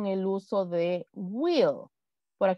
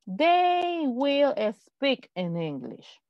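very slow, drawn out; slow model sentence; 3 semitones higher, much slower than usual. Shown as a demonstration. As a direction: stretch every word for the class. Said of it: "Vamos con otro sujeto". (frase modelo muy lenta)

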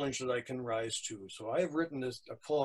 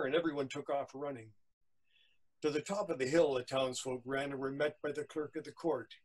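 ordinary delivery, nothing marked

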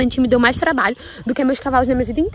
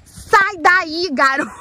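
high, loud get-out call; shouted order; 7 semitones higher, 6 dB louder, much slower than usual. Real, not acted. Owner shouting at her colt. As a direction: order him off firmly.

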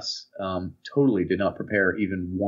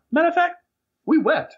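much higher mock declamation; improvising student orator; 4 dB louder than usual. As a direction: imitate a kid bluffing a grand line. Acts Drew's improvised line.

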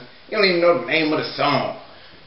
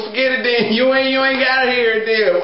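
ordinary talk

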